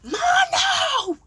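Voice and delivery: high, loud imitated holler; summoning yell. Housemate summoning her downstairs, imitated as a loud yell. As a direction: yell the name up the stairs. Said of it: "She called me like".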